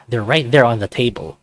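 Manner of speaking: ordinary delivery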